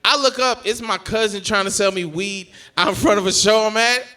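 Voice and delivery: ordinary talk, nothing marked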